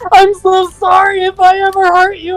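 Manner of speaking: high, pleading, overwrought apology; mock histrionics. Roleplayer's histrionic reply to the friend, pitched high. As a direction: whine the apology dramatically up high. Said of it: "the whole fucking pages of histrionics".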